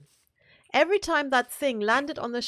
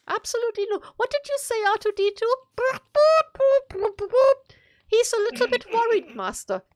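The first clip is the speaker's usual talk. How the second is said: higher, louder prim posh robot butler; fussy posh droid, pitched up; posh C-3PO voice; 10 semitones higher, 6 dB louder than usual. Retold or imitated. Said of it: "Then I have to put on a posh voice".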